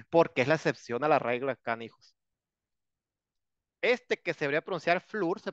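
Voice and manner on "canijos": usual speaking voice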